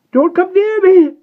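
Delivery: high, piercing shriek; full-voiced panicked scream. A narrator's reading. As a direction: shriek to keep them off. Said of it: "shrieked the vampire".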